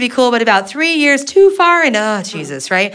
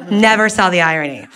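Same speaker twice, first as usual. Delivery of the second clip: ordinary talk, nothing marked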